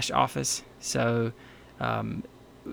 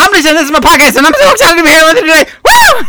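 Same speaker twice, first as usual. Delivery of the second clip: high, loud, fast hammed-up host voice; mock peppy podcaster; 13 semitones higher, 15 dB louder, much faster than usual. Wife parodying an overexcited podcast host. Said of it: "instead of going".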